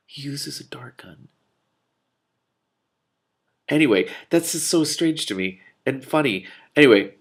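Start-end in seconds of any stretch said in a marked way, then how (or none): 0.1-1.3 whispered aside under the breath; hushed, giggly whisper; amused whisper. Real, not acted. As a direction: breathe it out, barely voiced.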